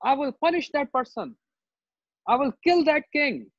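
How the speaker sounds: acted wrathful threats and demands; angry mood. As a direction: scold as an outraged warrior sage. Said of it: "So much angry mood".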